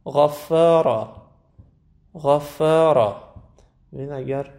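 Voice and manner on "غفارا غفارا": غفارا slow and loud, heavy غ then light فا; heavy GHAYN. Shown as a demonstration. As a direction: thicken only the غ and the را in غفارا, keep فا thin. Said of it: "استعلا داره". («غفارا» با غینِ درشت و فای نازک)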